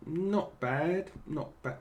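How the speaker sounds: usual speaking voice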